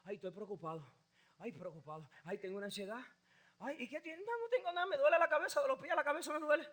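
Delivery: mock a complaining believer; mock worried believer.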